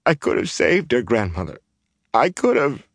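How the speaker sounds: high, broken-hearted protest; acted tearful lament; weeping. A narrator's reading. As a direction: say it through tears.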